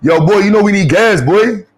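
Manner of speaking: loud, high New York hustler voice; New York accent. Retold or imitated. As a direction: bark it like a New York guy on the road. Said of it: "only New Yorkers talk like that".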